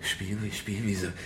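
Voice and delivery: soft, low, halting repeat; stunned. Acted. Captive echoing his kidnapper's word, stunned and soft. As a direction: repeat it in dazed disbelief.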